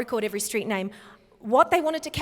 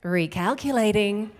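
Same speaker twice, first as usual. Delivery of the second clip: loud, deliberate satnav prompt; GPS navigation voice; 6 dB louder than usual. Shown as a demonstration. Giving the audience everybody's favorite, GPS voice. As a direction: announce it like a navigation system. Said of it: "And everybody's favorite".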